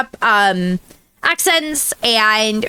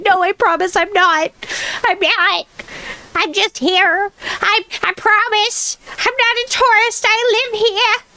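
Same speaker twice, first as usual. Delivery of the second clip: much higher, earnest pleading; pleading reassurance; 8 semitones higher than usual. Retold or imitated. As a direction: reassure a suspicious local, voice way up. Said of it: "And I'm like".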